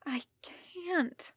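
high, unvoiced and wretched whisper. A narrator's reading. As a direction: gasp out the refusal in misery, no voice. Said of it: "And Rodney said wretchedly".

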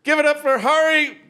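high, hyped host intro; stage introduction. Acted. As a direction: bring the comic on for the crowd.

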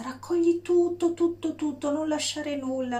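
urgent. Shown as a demonstration. Urging to gather all emotions.

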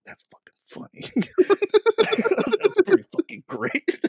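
helpless high laughter swallowing the words; cracking up. Real, not acted. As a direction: squeeze the words out through a laughing fit. Retelling own amusement, cracking up.

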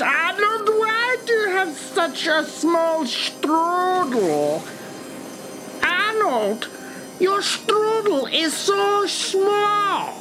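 put-on high-pitched schoolyard teasing in an Austrian accent; mock taunting. Bullies to Arnold about his strudel, high mock taunting.

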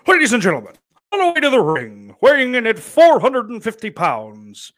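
big, high, loud ring announcer call. Acted. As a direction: announce a wrestler's entrance at full volume. Imitates a wrestling ring announcer.

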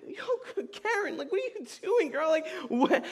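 high-pitched acted disbelief; baffled calling after a fleeing teammate; squeaky, bewildered protest, played for laughs; incredulous.

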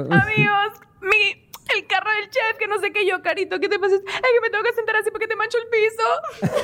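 laughing retelling. Retold or imitated. Retelling Carito's meltdown.

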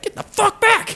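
very high, loud furious shriek. Retold or imitated. Driver screeches at cop opening her door, furious. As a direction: screech it at him as you kick.

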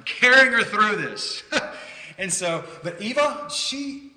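bursting out laughing mid-sentence; real laughter breaking through the story. Real, not acted. Laughing at his own panic.

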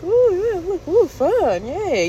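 high, singsong little cheer; naive excitement. Retold or imitated. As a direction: coo with mock delight. Acts out watching the ride happily.

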